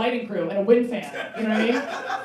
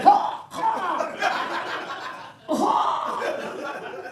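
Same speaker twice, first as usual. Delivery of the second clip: mock retching; 10 semitones higher, much slower than usual. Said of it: "You're like".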